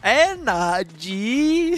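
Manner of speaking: slow drawn-out robot imitation. Shown as a demonstration. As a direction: drag the word out like a robot announcer. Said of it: "Why are we doing the robot voice".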